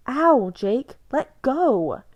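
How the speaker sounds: high, loud cry of pain. A narrator's reading. Bella cries out as Jacob hurts her. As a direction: yelp as he grips you.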